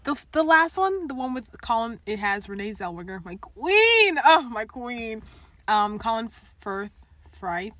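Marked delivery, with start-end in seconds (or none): 3.2-5.2 high, loud fangirl gushing; adoring gush. Real, not acted. Fan raving to co-host about favourite actress, adoring gush. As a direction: exalt her loudly, pitched up.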